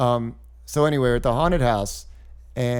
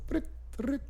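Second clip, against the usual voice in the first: phone buzz imitation; 13 semitones higher, 12 dB quieter than usual. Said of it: "just like".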